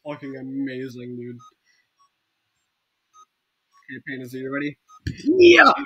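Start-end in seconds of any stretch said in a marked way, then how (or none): 5.2-5.7 very loud high yell; battle cry. Real, not acted. Player launching an attack, yelling. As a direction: explode into the charge. Charging again in game.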